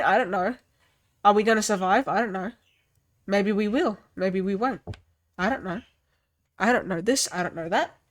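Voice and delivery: unsure android. Retold or imitated. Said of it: "he's just talking".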